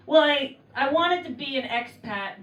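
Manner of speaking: normal talking voice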